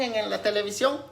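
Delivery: ordinary talk, nothing marked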